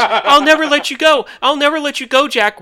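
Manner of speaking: high, loud, dramatic Rose wail; hammed-up tearful Titanic line; mock Rose. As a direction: ham up Rose's plea.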